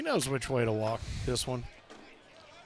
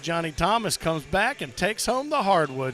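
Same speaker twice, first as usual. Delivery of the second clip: normal talking voice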